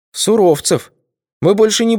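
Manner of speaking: high timid soldier voice; frightened young soldier. A narrator's reading. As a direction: answer meekly, scared of punishment.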